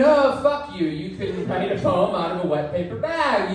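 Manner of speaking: lightly acted outrage; mock indignation. Acted insult to the Moon.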